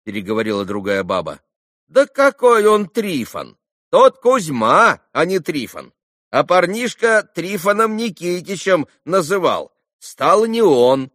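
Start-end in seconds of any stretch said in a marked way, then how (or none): 1.9-11.1 high, loud, drawn-out objection; contradicting market woman. A narrator's reading. Second trader correcting the first, loudly contradicting. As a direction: argue the name with certainty.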